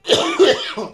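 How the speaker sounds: a real, strained high cough; hacking cough on a live stream; coughing fit. Real, not acted. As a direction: cough hard into the mic. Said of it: "I'm dying over here for y'all".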